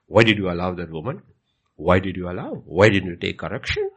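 a stern God scolding; stern rebuke. Retold or imitated. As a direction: hold the pastor to account.

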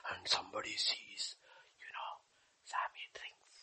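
hushed retold gossip whisper. A churchgoer whispering gossip about Sammy. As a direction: whisper it like spreading a rumour.

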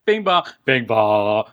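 slow, sing-song bing bong; Ruck impersonation. Pretend. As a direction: echo the chime in a light Ruck voice. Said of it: "That was a good Ruck impersonation".

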